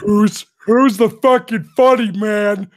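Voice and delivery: high, loud, slow drill-instructor demand; Technical Sergeant Ramscock. Retold or imitated. Imitating the T I hunting the prankster, slow shout. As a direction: shout it slowly as the angry sergeant.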